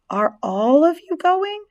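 high, slow, drawn-out question; worried Wilbur. A narrator's reading. Wilbur asks the departing spiders, high slow worried question. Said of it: "asked Wilbur".

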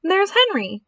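put-on high, eager girl's voice; high-pitched excited exclamation; excited cry. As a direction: call out a sighting excitedly. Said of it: "cried Fern".

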